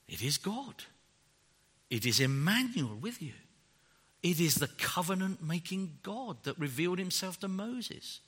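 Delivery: soft, low sermon voice; hushed preaching. Acted. Preacher reflecting quietly to the congregation, softly. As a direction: speak it close and quiet.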